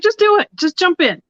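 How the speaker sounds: high, eager push to go for it; upbeat encouragement. Retold or imitated. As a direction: cheer her on, bright and pushy. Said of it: "she's like".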